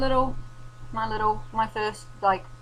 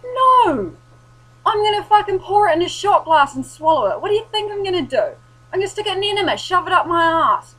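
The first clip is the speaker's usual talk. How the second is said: shrill high shouted sarcasm, much higher and louder; sarcastic furious rant; 11 semitones higher, 12 dB louder than usual. Retold or imitated. Ranting back at the condescending shop guy, high sarcastic yell. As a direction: yell it up high, mocking how stupid the question is. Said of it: "It's like".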